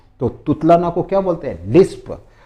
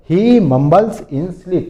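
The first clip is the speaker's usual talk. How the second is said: very slow, slightly louder; slow model phrase; 4 semitones higher, 4 dB louder, much slower than usual. Shown as a demonstration. Teacher models the English phrase slowly for students. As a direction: spell the sentence out slowly. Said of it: "इसको क्या बोलते हैं?".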